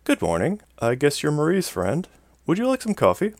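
high, sing-song politeness with rising questions; fake-sweet hostess voice through a smile; put-on smile. A narrator's reading. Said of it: "putting on a smile for the man".

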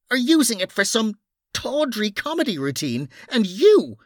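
high, outraged telling-off; indignant scolding. Acted. Noreen rounding on Bunny and then Mara, outraged. Noreen's tirade at two men.

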